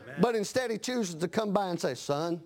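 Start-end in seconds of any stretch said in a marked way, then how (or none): 2.0-2.4 Holy Spirit speaking. Acted. The Lord speaks gently.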